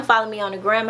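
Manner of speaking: ordinary talk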